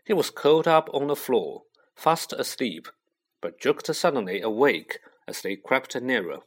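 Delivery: ordinary delivery, nothing marked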